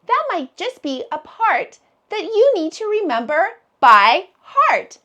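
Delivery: ordinary talk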